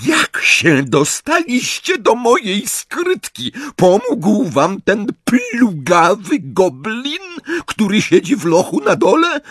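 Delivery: slow, high, loud interrogation; Bellatrix. A narrator's reading. Bellatrix questions Hermione about her vault, furious. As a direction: demand it in drawn-out, high fury.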